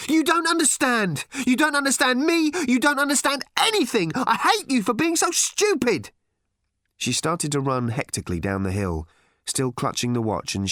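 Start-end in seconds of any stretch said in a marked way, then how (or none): 0.1-6.1 high-pitched, furious tirade building on repeats; angry shouting. A narrator's reading. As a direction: scream it out like an enraged child.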